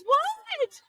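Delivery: high startled yelp. Retold or imitated. Friend woken at night, a high startled what. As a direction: jolt awake and squeak it out. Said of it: "And she goes".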